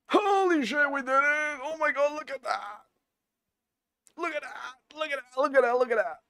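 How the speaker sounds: high, excited squealing; giddy relief. Real, not acted. Line numbers finally appear.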